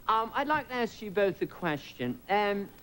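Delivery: slow, earnest mock British intellectual; intellectual British journalist. British press questions the comedian, intellectual British accent. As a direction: draw it out with scholarly British precision. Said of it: "these very intellectual British people were going".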